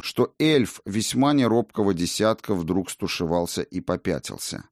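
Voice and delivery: plain reading voice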